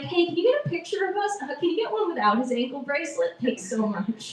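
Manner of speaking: high, fast and perky; bright stranger-friendly ask for a photo; chirpy polite request. Pretend. Girlfriend asking a stranger at the pumpkin patch, perky and fast.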